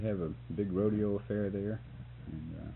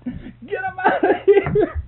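drawn-out shout, much higher and louder; slow yell; 14 dB louder, much slower than usual. Retold or imitated. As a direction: stretch the yell out so it rings. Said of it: "They just yelled it out".

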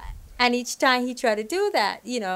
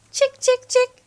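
high, loud sing-song call of chick, chick, chick; wolf coaxing the chicks with high calls; 10 dB louder, slower than usual. Retold or imitated. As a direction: lure them in with a bright high call. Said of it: "the wolf would go".

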